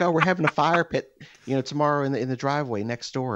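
high, sing-song mock neighbor; chirpy neighbors. Mocking neighbors' barbecue invite, sing-song. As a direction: imitate cheerful neighbors inviting him. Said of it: "then they're just like".

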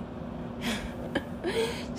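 airy laugh that rises high; soft laugh at her own story; breathy high laugh. Real, not acted. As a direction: let a breathy laugh float up.

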